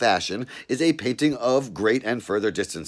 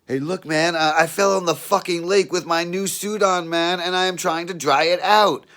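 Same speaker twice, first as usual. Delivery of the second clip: raised, louder pleading voice; nervous explaining; 9 semitones higher, 5 dB louder than usual. Acted. Caught naked in the bushes, pleading loudly with the cop. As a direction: talk your way out of trouble.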